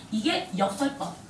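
usual speaking voice

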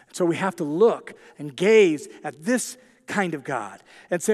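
usual speaking voice

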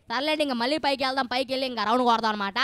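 usual speaking voice

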